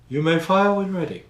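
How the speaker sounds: mild imitation of an Imperial officer's order; Grand Moff Tarkin. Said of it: "That's how he says it".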